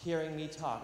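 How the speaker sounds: ordinary talk, nothing marked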